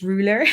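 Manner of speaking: "ruler" with awkward, muddled R sounds; "ruler" said as a word you can't manage; struggled pronunciation. Shown as a demonstration. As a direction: stumble on the Rs in "ruler". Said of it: "certain words that I cannot say categorically".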